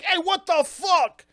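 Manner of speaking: high, loud, drawn-out protest; hammed-up indignant exclamation; acted outraged janitor. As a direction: yelp in mock outrage. Acting out startled angry janitor.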